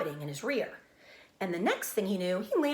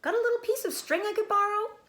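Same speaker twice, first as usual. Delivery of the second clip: much higher eager piglet voice; Wilbur the pig; 4 dB louder than usual. A narrator's reading. Pig asks the rat for string, high piglet voice. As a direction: ask sweetly like a young pig.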